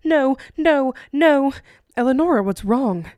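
startled awake and frightened; alarmed shout. A narrator's reading. Eli waking to his wife's gasp, loud and alarmed.

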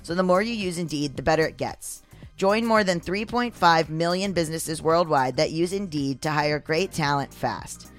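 ordinary talk

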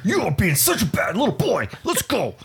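high scolding voice; scolding owner. Retold or imitated. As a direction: tell off a naughty dog. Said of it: "I was like".

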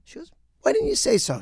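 woman neighbour suddenly won over, in a high-pitched voice; chirpy woman. Retold or imitated. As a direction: coo it like a delighted neighbour.

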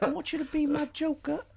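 very high put-on English accent; mock romantic English plea. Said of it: "with his little English accent".

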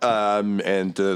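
ordinary talk

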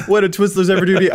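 fast, high mock indignation; fake hurt pouting. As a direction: act wounded on Twizzlers' behalf. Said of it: "I'm just pretending to be offended on behalf".

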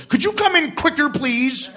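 high, pressing mock-polite request; strained high voice, pointedly polite; imitating Tom Cruise. Star to actor who flubbed the take, imitated, high and pointed. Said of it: "Tom Cruise looks at me and goes".

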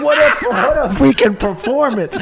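high, loud and laughing through it; laughing self-praise. Retold or imitated. Actor praising his own performance, laughing loudly. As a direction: crow over yourself, laughing. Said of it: "I was like".

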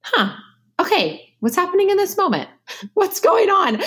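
high, gently wondering questions with a laugh; kind and curious. Retold or imitated. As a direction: sound curious instead of angry. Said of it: "get kind and curious about".

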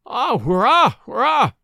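high, loud lamenting wail. A narrator's reading. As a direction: cry it out in despair. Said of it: "wailed the cook".

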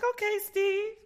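very high, playful quote; high approving coo. Retold or imitated. Fan cheering on Steve's outfit, high playful voice. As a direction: pitch it way up in mock admiration. Reacting to his denim outfit.

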